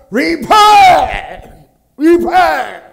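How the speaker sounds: imprisoned prophet shouting at his guards. Retold or imitated. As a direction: yell it like a jailed prophet crying out. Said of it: "sigaw pa ng sigaw".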